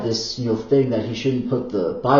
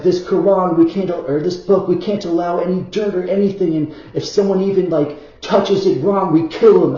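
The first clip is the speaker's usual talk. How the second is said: imitating a zealot, higher and louder, building to a shout on kill him; hammed-up fanatic laying down rules, pushing ever louder; mock zealot voice; 7 semitones higher, 7 dB louder than usual. Mocking strict Quran rules, in a high put-on voice building to a loud end. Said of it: "and they're like".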